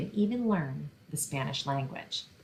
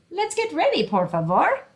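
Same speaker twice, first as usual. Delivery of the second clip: much higher, louder mom voice calling out; Mommy calling; 10 semitones higher, 5 dB louder than usual. Acted. Mother hurrying her son to get ready, high sing-song call.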